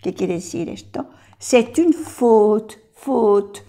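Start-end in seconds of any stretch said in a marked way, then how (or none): 1.4-3.6 "C'est une faute" drawn out, "une" leaned on; "C'est une faute" slowly, "une" weighted; slow demo. Shown as a demonstration. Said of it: "Es una falta".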